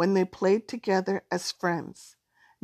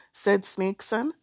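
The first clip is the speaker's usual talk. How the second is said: plain reading voice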